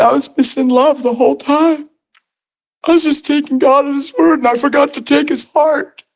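high, tearful confession, acted out; imitating tearful bawling. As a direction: confess as if weeping, pitched high. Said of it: "He's bawling".

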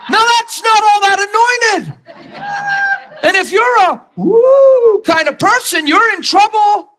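very high-pitched, laughing exclamation; high excited shout. Real, not acted. Preacher joking with the audience, high excited shouting. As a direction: cry it out over the crowd's laughter.

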